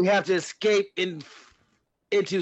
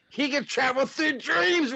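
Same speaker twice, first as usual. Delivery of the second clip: much higher, rising alarm; 9 semitones higher than usual. Acted. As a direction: push the panic higher. Morty panics, rising.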